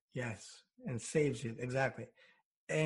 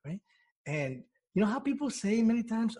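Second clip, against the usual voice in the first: ordinary talk, nothing marked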